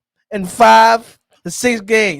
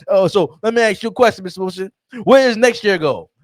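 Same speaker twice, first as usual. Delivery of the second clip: usual speaking voice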